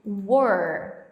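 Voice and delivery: Were said like "uór", rounded; pronunciation demo. Shown as a demonstration. Teacher modelling Were for students, rounded "uór". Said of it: "a gente tá falando assim".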